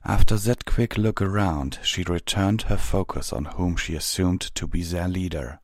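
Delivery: usual speaking voice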